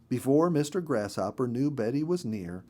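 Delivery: plain reading voice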